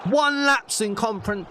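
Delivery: fumbling the word conference; flustered slip on conference; tongue-tied. Real, not acted. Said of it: "Oh, I can't even speak".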